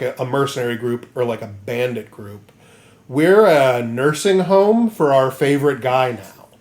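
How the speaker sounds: ordinary talk, nothing marked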